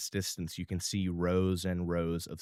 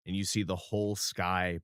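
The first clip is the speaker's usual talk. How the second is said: ordinary delivery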